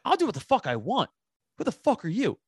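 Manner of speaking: high-pitched reenacted indignation; losing temper. Defying the tough-talking husband, retold heated challenge. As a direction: push the pitch way up, defiant. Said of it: "I'm losing my".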